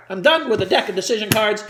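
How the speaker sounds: ordinary talk, nothing marked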